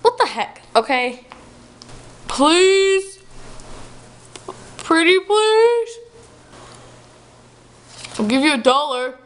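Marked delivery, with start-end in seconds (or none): 2.3-6.0 high, drawn-out pleading; begging. Acted. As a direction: beg like a whiny kid. Dad begging daughter.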